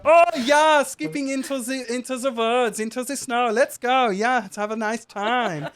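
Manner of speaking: high, excited resort host, loud at first; gleeful accented gnome. Acted. Gnome manager leading guests into the snow, gleeful. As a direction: skip off merrily in a high accented voice. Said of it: "You are".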